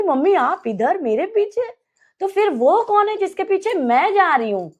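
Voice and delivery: realizing the figure isn't mom; frightened realization. Retold or imitated. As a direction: pitch climbing with dawning fear. Realizes mother is behind her.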